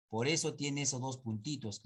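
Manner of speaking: ordinary delivery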